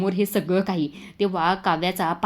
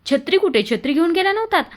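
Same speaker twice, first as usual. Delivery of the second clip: higher and louder, rising in disbelief; mother demanding where the umbrella is; exasperated scold; 10 semitones higher, 6 dB louder than usual. Acted.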